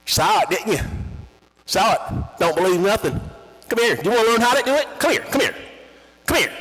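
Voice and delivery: high, loud coaxing call; Kriegel. Retold or imitated. Kriegel inviting the boy to learn. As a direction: beckon the kid over.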